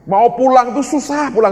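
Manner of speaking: high, loud and insistent; exasperated. Retold or imitated. Preacher retelling a stubborn visitor, loudly exasperated. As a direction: push it out high and forceful. Retold argument about going home.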